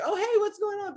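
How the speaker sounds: breezy fake innocence; mock casual greeting. Family casually greeting Timmy.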